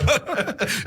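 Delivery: light breathy laugh, high and soft; a quick amused giggle. Real, not acted. Host laughing at his own long introduction, soft laugh.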